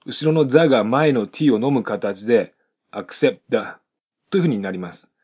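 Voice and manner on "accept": "accept" with no audible final T; silent final T. Shown as a demonstration. Teacher models T swallowed by the. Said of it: "acceptのTが聞こえません".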